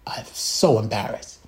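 low, quiet, whispered regret; embarrassed whisper. Retold or imitated. Backstage, embarrassed at himself, whispering low and quiet. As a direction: hush the words in shame. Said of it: "And I was like".

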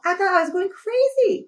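high, pleading, indignant; upset outburst. Retold or imitated. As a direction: plead with outrage, voice breaking. Retold tearful outburst at manager.

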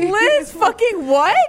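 shocked. Real, not acted. Shocked at Liz crying.

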